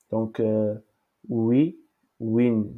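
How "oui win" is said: ordinary talk, nothing marked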